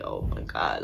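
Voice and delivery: very low, creaky fed-up groan; muttered exasperation at the bottom of the voice; exasperated. Retold or imitated. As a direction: let it sink low in weary disbelief. Exasperated that cousin wants home.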